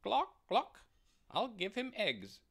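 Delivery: high, clucking hen voice; fussy clucking hen. Acted. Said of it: "said the hen".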